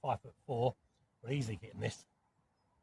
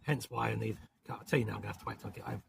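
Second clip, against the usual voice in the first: usual speaking voice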